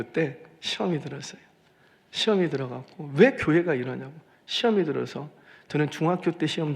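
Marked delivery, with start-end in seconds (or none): none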